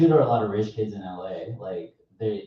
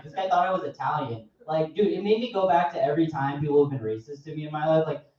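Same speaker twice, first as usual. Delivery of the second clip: normal talking voice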